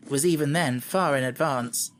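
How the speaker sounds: usual speaking voice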